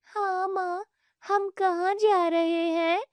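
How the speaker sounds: put-on high, slow child voice; small girl asking. Younger daughter asking mother where they are going, high and slow. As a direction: ask timidly where they go.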